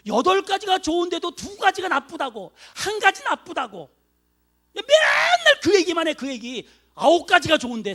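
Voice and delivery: loud, high, mock-exasperated rant; exasperated shout. Pastor mimicking a nagging wife, loud and fed up. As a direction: let it burst out, fed up with her nagging.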